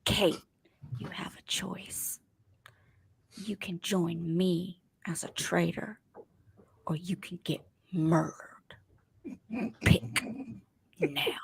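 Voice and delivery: soft, unbothered whispered threat; calm Phaedra whisper. Retold or imitated. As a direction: hush it like a calm ultimatum. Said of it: "And she says".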